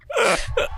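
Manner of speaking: high giggling burst; real laughter mid-read. Real, not acted. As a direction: crack up laughing. Breaks up reading.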